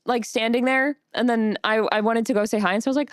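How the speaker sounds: usual speaking voice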